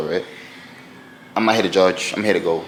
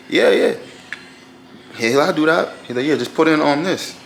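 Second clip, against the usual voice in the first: high, eager hustler voice; mock friendly attendant voice; parking attendant; 7 semitones higher than usual. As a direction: play the smooth parking hustler, pitched up.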